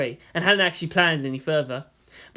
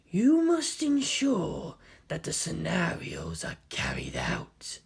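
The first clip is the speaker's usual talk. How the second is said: slow, much softer sinister whisper; intoned whisper; 6 dB quieter, slower than usual. Acted. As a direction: hiss it from the shadows. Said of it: "he intoned".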